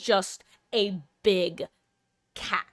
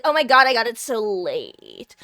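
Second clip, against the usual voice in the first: louder mock dismay, stretching the last word; mock complaining; 7 dB louder than usual. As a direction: put on an exaggerated lament. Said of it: "not trying to be like".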